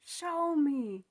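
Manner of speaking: indignant imagined retort; defiant challenge. Retold or imitated. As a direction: dare them to prove you wrong. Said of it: "I'm thinking to myself".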